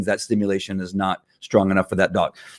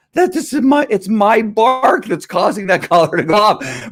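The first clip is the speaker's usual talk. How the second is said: high, slower cartoon-dog voice; dog's voice; 11 semitones higher than usual. Dog realizing, voiced by owner.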